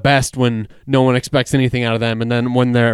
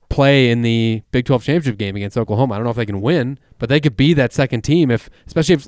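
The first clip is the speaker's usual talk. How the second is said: normal talking voice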